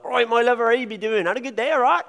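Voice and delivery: high, loud broad Devon greeting; broad Devon accent. Retold or imitated. Devon locals greeting a friend, in a high, loud, broad Devon accent. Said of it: "a really broad accent".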